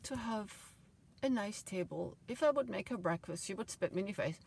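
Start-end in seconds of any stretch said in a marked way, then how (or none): none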